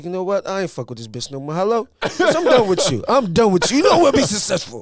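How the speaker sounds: high, loud, laughing yell; mock furious breakup rant. Dumping girlfriend, acted rant.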